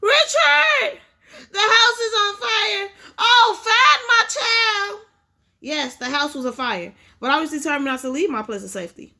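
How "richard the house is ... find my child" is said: high, loud, slow desperate shouting; mother begging for her child in a fire; frantic mother. Acted. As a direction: plead to the crowd in panic. Mother searching burning house.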